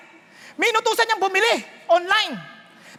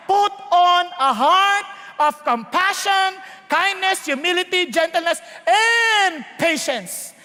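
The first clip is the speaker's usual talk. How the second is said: emphatic preaching; slower than usual. Real, not acted. Preaching the command of scripture.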